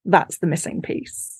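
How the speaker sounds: hushed, breathy aside to yourself; whispered realization. Retold or imitated. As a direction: whisper it like a secret discovery. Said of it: "And I was like".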